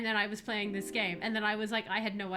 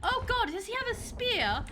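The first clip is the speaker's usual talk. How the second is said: tag; 9 semitones higher, 5 dB louder than usual. Pretend. Player keeps up mock warning.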